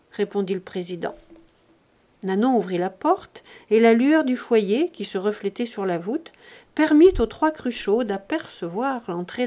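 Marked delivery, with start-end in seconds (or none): none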